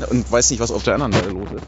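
normal talking voice

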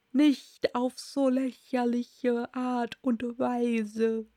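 very slow, dragged-out creature voice; verdrießliches Murmeltier. Acted. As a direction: drag it out as a sulky Dormouse. Dormouse sulks at Alice. (verdrießlich gedehnte Murmeltierstimme)

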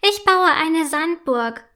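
höher, laut und langsam gerufen, leicht kindlich; ruft laut. A narrator's reading. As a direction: freudig über den Strand rufen. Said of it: "ruft Sophie". (Sophie ruft ihren Eltern freudig zu, laut und langsam)

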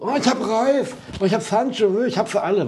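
high, mock-boastful bragging; hammed-up braggart voice; mocking show-offs. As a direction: parody the others bragging in the group. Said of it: "so breit getreten wie andere".

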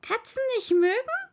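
high, loud screeching outrage; small animal shrieking in anger; shrieking furious mouse. Acted. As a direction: screech the words back at her in fury. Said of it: "schrie die Maus mit kreischender, wütender Stimme".

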